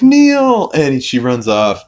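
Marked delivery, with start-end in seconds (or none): 0.0-0.7 high, loud cry of a name; yelling a name. Retold or imitated. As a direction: scream his name in panic. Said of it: "She's like".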